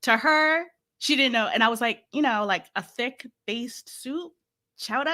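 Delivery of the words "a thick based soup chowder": put-on Massachusetts accent. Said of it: "very thick Massachusetts accent".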